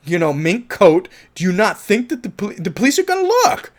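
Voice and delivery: fast, loud, pitch spiking on police; exasperated rant. Retold or imitated. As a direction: scold a careless partner in disbelief. Keeps ranting in Jimmy's shoes.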